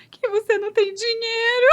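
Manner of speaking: high imitated child voice; lightly imitated little girl. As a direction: answer plainly like a small child. Daughter's answer voiced.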